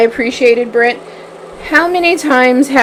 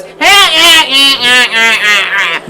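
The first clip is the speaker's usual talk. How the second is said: laughing guy; 5 dB louder than usual. Retold or imitated. Podcast host mocks the laughing man at the show, imitating his laugh. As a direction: mimic the guy at the next table cackling. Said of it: "the guy at the table beside us that goes".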